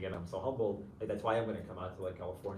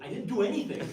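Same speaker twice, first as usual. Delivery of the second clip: much higher and louder, mock disbelief; incredulous protest; 11 semitones higher, 9 dB louder than usual. Chaplain recalling his reply to a grateful student, incredulous and loud. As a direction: blurt it out, amazed you get the credit. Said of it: "I was like".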